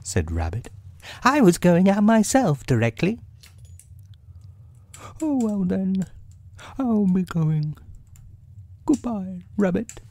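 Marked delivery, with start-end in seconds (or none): 1.2-3.2 higher, brisk character voice; quick, lifted storybook animal voice; Rabbit. A narrator's reading. Rabbit answering his guest, brisk high voice. 5.2-10.0 high-pitched bear character voice; storybook bear, pitched well up; Pooh. A narrator's reading. Pooh taking leave of Rabbit, high bear voice.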